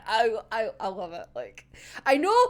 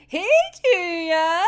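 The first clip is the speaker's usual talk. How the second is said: teasing Dazai; 6 semitones higher, 5 dB louder than usual. Retold or imitated. Dazai voiced greeting Chuya.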